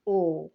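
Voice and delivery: او with lips rounded, held long; rounded o demo. Shown as a demonstration. Teacher showing pupils the rounded او sound. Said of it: "ہم او کی آواز اس طریقے سے نکالتے ہیں".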